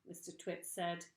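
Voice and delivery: plain reading voice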